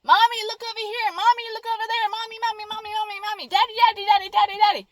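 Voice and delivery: put-on high, whining child calling for attention; whiny child. Mocking a child in a tantrum calling for parents, high whine. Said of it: "like a child throwing a tantrum".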